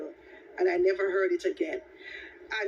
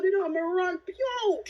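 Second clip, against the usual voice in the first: ordinary talk, nothing marked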